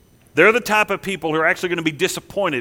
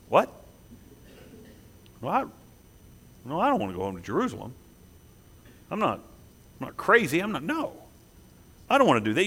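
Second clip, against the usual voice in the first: softer, hurried, backing-off mumble; mock refusal; 2 semitones lower, 7 dB quieter, faster than usual. Preacher voicing believers refusing to leave, softly backing off. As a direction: shrink back nervously.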